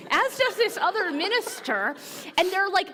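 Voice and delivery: ordinary talk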